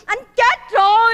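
loud, slow, drawn-out shout; exasperated outburst. Acted. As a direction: blurt out the bad news at full volume. Blurts out the husband's death.

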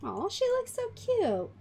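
high, swooping adoring coo. Real, not acted. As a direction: gush over a cute little one. Admiring a dressed-up Sim.

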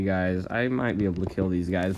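ordinary delivery

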